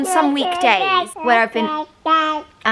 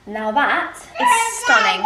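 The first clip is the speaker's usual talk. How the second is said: much higher, slow and drawn out; savouring; 11 semitones higher, slower than usual. Real, not acted. Mum tasting her glass of wine, slow and delighted. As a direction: draw out the word stunning in relish.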